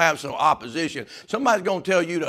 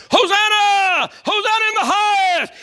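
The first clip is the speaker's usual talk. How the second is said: shouting praises; 7 dB louder than usual. Acted. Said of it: "they're all shouting the praises".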